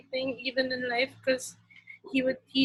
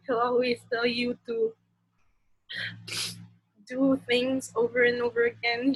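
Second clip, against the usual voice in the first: ordinary talk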